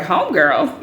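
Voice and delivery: laughing, rising homegirl; amused disbelief. Retold or imitated. Hearing she was called his homegirl, laughing disbelief. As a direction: repeat the word in amused disbelief. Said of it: "And I was like".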